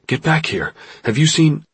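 high, urgent shout cut off. A narrator's reading. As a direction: shout orders down the phone. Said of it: "he shouted".

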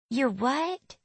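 high Southern twang; Southern accent. A narrator's reading. Puzzled girl asks the newcomer, twangy Southern accent. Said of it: "her voice twangy with a southern accent".

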